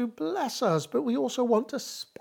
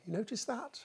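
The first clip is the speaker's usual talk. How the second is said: whispered aside; 2 semitones higher, 10 dB quieter, faster than usual. Real, not acted. Preacher asks congregation a question.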